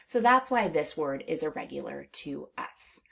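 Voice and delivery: normal talking voice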